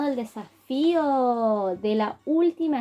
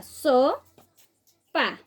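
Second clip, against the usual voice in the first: SOPA split as So, long pause, pa; segmented syllables; 2 semitones higher than usual. Shown as a demonstration. Teacher segmenting the first word for children, syllable by syllable. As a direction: say Sopa in two isolated beats. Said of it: "Vamos, con la primera".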